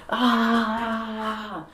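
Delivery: long high-pitched wail, imitated; hammed-up drawn-out "aah" scream, not very loud; mock dramatic scream. Punched man screaming as retold by the storyteller, mock dramatic scream. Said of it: "screamed so fucking loud and".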